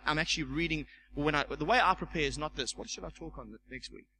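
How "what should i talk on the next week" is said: much softer, lower imitation of pondering; musing to oneself. Preacher imitating someone preparing next week's talk, quiet musing. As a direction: quietly think aloud, barely projected. Mimics aimless sermon preparation.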